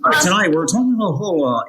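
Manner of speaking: high, fast news intro voice; news anchor voice. Retold or imitated. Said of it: "intros for the news".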